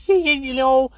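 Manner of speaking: high-pitched, stammering Scouse caricature; hammed-up ex-footballer, high and halting; Roy Keane. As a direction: moan and boast in a high mock-Scouse voice. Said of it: "I don't know why he's Scouse".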